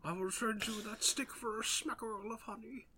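high, soft, dreamy bear voice; Pooh voice. Acted. Playing Pooh for the storyteller, soft high bear voice. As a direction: sound like the gentle honey bear.

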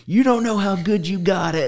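high, loud, laughing scolding parent. Retold or imitated. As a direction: tease like a know-it-all parent. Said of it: "That's like a parent saying".

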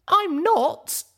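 high, defensive filly voice; high young mare voice. Acted. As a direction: talk yourself out of it, flustered. Pip arguing.

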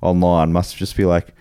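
ordinary talk

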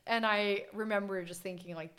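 ordinary delivery

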